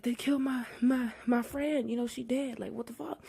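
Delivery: high, halting mock upset; hammed-up high-pitched distress; imitated freak-out. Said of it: "the nun's freaking out".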